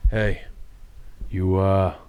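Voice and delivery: out of breath, low and cocky; panting after push-ups. Acted. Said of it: "a little bit of a pant".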